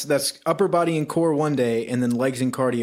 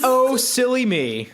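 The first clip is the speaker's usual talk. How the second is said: mock silly; 8 semitones higher, slower than usual. Mock correcting the slip.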